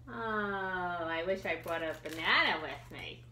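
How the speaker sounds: ordinary delivery, nothing marked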